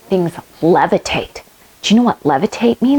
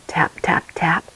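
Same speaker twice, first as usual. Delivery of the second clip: soft whispered magic taps; 6 semitones lower, 7 dB quieter than usual. Pretend. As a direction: whisper the spell.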